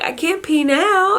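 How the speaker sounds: high, slow mock whine; crying protest. Tearful patient to the nurse, high slow whine. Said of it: "I was crying again".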